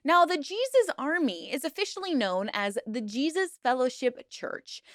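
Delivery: ordinary talk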